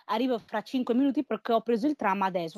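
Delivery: normal talking voice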